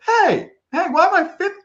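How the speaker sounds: very high, loud mock indignation; indignant protest. Podcaster protesting being ranked after other shows, indignant. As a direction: squawk it in mock offence. Acted indignant reply to listener.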